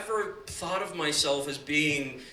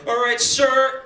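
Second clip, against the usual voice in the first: loud, higher stern officer voice; airport officer; 7 semitones higher, 8 dB louder, slower than usual. Retold or imitated. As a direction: bark it like a customs officer addressing a kid. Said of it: "It's like".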